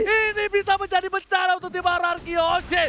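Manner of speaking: excited play-by-play call; hyped esports commentary; esports caster. Real, not acted. Casting big moment.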